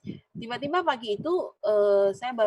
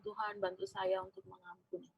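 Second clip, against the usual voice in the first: hushed prayer; 3 semitones lower, 11 dB quieter than usual. Retold or imitated. Tearful prayer alone in office.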